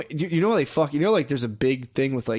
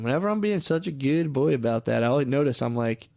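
ordinary talk, nothing marked

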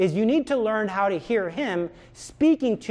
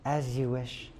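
devoted Wesley; 6 semitones lower, 8 dB quieter, slower than usual. Retold or imitated. Wesley's repeated reply.

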